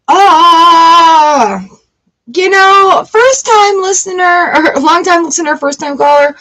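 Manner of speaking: mock radio host. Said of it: "radio host greeting".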